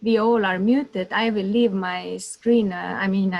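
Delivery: normal talking voice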